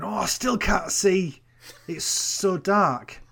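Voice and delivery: slow whiny impression of the policewoman; mock policewoman. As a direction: drawl it like a helpless cop. Mocking policewoman's line.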